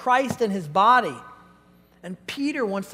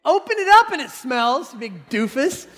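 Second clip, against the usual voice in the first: higher, louder exasperated scold; 4 semitones higher, 5 dB louder than usual. Retold or imitated. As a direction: chew out the doofus. Exasperated leader scolding boy.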